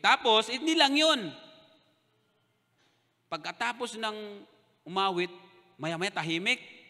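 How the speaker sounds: ordinary delivery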